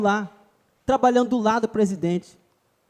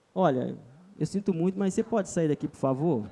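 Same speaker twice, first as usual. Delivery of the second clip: soft, lower, overly polite asking; mock timid please-leave request; 6 semitones lower, 8 dB quieter than usual. Asking someone politely to leave, soft and timid. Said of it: "Expulsar alguém é assim".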